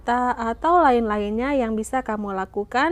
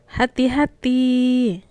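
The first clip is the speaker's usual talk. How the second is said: hati-hati said slowly and higher, falling at the end; warning tone; 4 semitones higher, slower than usual. Shown as a demonstration. Teacher models a slow warning for pupils. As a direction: stretch it out like a warning. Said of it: "Gunakan intonasi yang benar ya".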